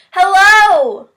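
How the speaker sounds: big high shout up to the sky. A narrator's reading. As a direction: call out loud across the mountains. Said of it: "again he shouted".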